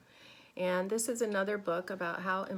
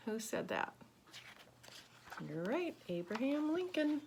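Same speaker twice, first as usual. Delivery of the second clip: ordinary delivery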